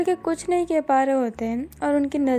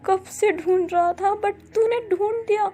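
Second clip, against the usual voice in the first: overjoyed; 5 semitones higher than usual. A narrator's reading. Boss gushes thanks to his assistant, overjoyed. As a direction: pour out delighted gratitude. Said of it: "अब जाओ खुश हो जाता है".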